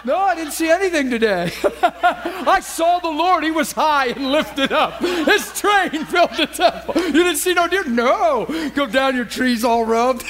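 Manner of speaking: high and slow, breaking into laughter; can barely get the words out for laughing; laughing through quote. Retold or imitated. Preacher to congregation, retelling the tree-stand joke, laughing high and slow. Retelling wife's hunting teasing.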